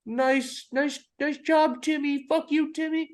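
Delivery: high whiny schoolyard mockery of a manager; mocking schoolyard kid. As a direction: sneer it like a petty kid on the playground. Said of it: "like a fucking schoolyard kid".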